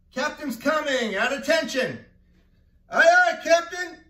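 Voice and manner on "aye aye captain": very loud high sailor's answer; loud aye aye. Pretend. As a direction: snap back like a deckhand. Answering the captain loudly.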